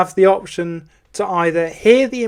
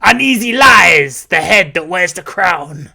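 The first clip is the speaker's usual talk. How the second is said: demo impression line; 5 dB louder than usual. Shown as a demonstration. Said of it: "So, for instance, it would be".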